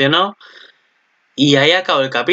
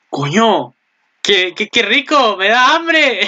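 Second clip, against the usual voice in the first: imitating himself drooling, voice rising much higher; craving exclamation; 7 semitones higher, slower than usual. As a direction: relive the hungry "so tasty" moment, pitched up. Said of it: "porque dije". (exclamación de antojo, cada vez más aguda)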